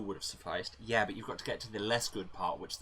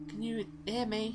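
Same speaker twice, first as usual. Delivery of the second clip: much higher voice calling out over the radio; high comm caller; 13 semitones higher than usual. Acted. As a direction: call out high, trying to reach Neil.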